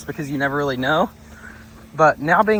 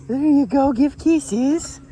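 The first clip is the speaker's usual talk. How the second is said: high, sing-song encouragement; coaxing dog-talk; 9 semitones higher, much slower than usual. Real, not acted. Coaxing her dog to greet.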